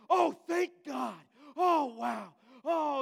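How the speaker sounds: loud, high, slow cry of relief; mock overjoyed shout of relief; huge relief. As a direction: let it burst out like a weight just lifted.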